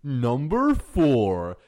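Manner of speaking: hammed-up countdown narrator with swooping pitch; Watch Mojo. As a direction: parody a list-video host, big rises and drops. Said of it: "on brand with the Watch Mojo theme".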